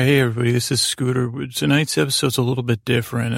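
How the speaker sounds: ordinary talk, nothing marked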